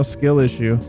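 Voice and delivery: ordinary delivery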